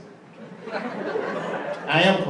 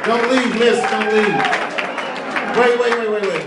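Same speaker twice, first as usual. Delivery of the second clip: loud, higher pleading call; 6 semitones higher, 6 dB louder than usual. Real, not acted. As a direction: shout after her to stay. Calling to a leaving audience member.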